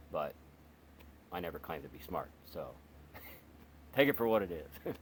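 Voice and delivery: ordinary talk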